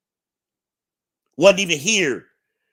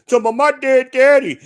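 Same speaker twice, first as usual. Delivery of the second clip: much higher, louder mocking voice; mocking her; 11 semitones higher, 7 dB louder than usual. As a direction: parody her whiny claim. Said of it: "She ain't repeat that shit".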